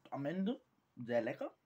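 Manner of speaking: normal talking voice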